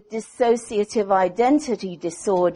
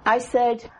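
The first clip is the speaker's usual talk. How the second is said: ordinary talk, nothing marked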